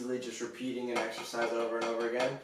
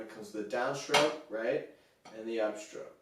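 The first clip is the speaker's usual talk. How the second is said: ordinary talk, nothing marked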